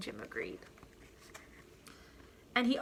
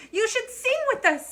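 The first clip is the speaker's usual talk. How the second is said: much higher, cheery bird voice; chirpy birds; 13 semitones higher, 6 dB louder than usual. Acted. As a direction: sing it out like a small bird. Birds invite Jim.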